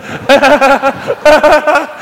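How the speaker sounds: loud high burst of laughter; real hearty laugh; big laugh. Real, not acted. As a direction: crack up laughing. Laughing at mum story.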